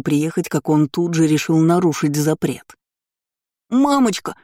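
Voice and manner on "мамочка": high, whimpering call for mommy; sobbing child. A narrator's reading. Boy appeals to his mother, sobbing. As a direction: whine it like a tearful boy. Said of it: "всхлипывал поганец".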